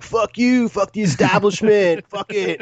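put-on high, loud teen punk sneer; snarling punk. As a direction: spit it like a rebellious teen. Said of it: "sixteen-year-old me would be like".

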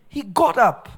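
ordinary talk

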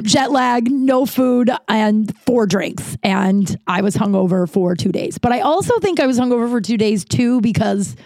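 usual speaking voice

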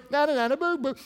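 high sing-song teasing; childish taunt. Acted. Preacher as Samson mocking Delilah, teasing. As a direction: taunt like a kid in a playground.

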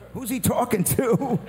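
high, slow puzzled question; mock confusion, drawn out up high; bewildered. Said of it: "And we were like".

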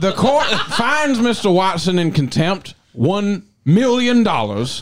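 high, loud, stammering judge voice; pompous judge thundering from the bench; blustering judge. Acted. Mock trial, judge ruling.

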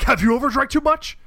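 high, loud, defensive echo; hammed-up indignant retort; mock Kavanaugh throwback. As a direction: fire the question straight back. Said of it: "he's just like".

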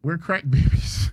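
laughing. Real, not acted. Teasing about being crack babies.

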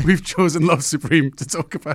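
laughing through the words; giggling as she announces it. Real, not acted. Laughing while announcing the pick.

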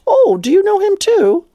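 match her excitement with a grin; eager, matching energy. Retold or imitated. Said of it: "I kinda matched her energy with a big smile".

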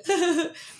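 high mock sobbing; stuttered, acted-out sobs; imitated bawling. Storyteller imitating herself bawling before the ice dunk, mock sobbing. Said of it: "I'm bawling".

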